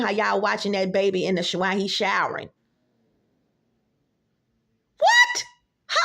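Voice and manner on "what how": high, loud indignant What; shrieking disbelief; outraged shout. Real, not acted. Outraged reaction to video.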